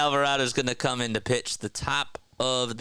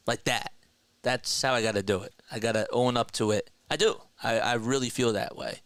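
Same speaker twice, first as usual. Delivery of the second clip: usual speaking voice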